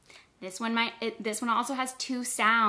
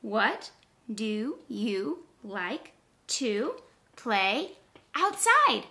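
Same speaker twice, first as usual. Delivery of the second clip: word by word; much slower than usual. Shown as a demonstration. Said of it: "Let's count it".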